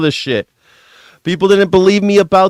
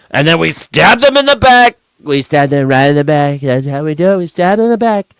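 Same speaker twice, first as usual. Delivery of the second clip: mock Trump. Voicing American leaders mockingly.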